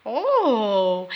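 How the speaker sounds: usual speaking voice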